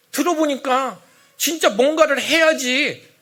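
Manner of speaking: high, loud, insistent reasoning; worked-up voice of a doubting congregant; agitated persuasion. Acted. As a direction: argue as a newly swayed believer. Acted swayed church members arguing.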